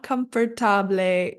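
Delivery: COMFORTABLE ending in "-ta-blee"; mispronounced COMFORTABLE. Shown as a demonstration. Teacher shows students another error, COMFORTABLE as kum-fer-ta-blee. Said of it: "that's not quite right".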